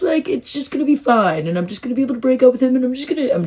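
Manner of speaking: high-pitched mock rant at the universe; hammed-up exasperation, pitched well up throughout; retold shouting. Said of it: "that's what I'm shouting aloud".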